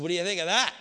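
loud, punchy retold challenge; triumphant challenge. Preacher retells challenging the man whose leg grew, loud and triumphant. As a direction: call it out loudly to the doubters.

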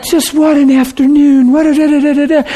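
high-pitched gibberish patter; excited babbling. Retold or imitated. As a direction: imitate people babbling away excitedly. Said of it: "they're babbling like".

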